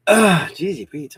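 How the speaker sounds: hiccup catching the breath. Real, not acted. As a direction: speak through a hiccup. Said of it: "I wish I'd stop hiccuping".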